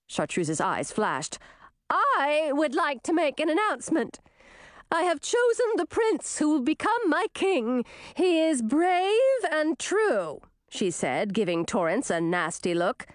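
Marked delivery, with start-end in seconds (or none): 1.9-10.4 high, haughty princess declaration; high, put-on regal voice; princess announcement. A narrator's reading. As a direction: proclaim it grandly, pitched well up. Angry princess makes grand announcement.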